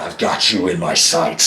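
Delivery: low, slow soldier impression; soldier from Overwatch. Imitating her threat as a game soldier, low menace. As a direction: growl of a game soldier taking aim, kept low and slow. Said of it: "she gave me the soldier from Overwatch".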